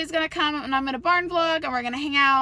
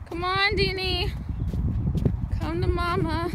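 coaxing; 5 semitones higher than usual. Real, not acted. Coaxing horse to come.

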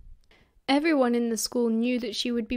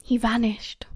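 usual speaking voice

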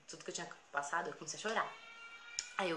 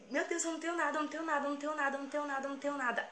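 high, rapid-fire panicked repetition; desperate pleading; 8 semitones higher, faster than usual. Retold or imitated. Girl pleading with her robber, desperate. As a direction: retell it as a desperate high-pitched plea. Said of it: "eu tava tão desesperada".

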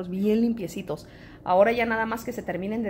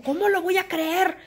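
high, louder outraged complaint; mock indignant viewer; 8 semitones higher, 3 dB louder than usual. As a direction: play an offended busybody. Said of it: "me reclamaba así como".

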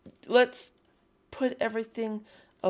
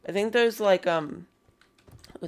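ordinary delivery

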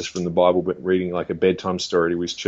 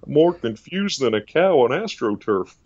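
slow Texan impression, raised pitch; Doctor Phil; 5 semitones higher than usual. TV therapist with a Texan saying, slow drawl. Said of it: "all these Texan sayings".

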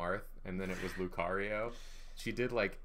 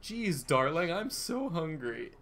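higher, slow, lightly mocking the game character; mocking the NPC; 7 semitones higher, 3 dB louder, slower than usual. Mocking a game character's stiff line, slow and higher. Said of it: "No one talks like this".

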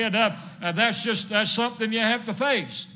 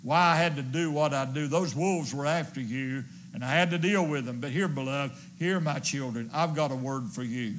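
soft, low, intimate reassurance; father explaining to his children up close, hushed and low; tender father; 6 semitones lower, 4 dB quieter than usual. Acted. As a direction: speak close and gentle to frightened children. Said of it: "pulls those children in close".

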